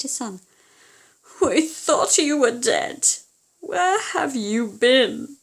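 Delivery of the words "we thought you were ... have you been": overjoyed father. Acted. Father greets lost son.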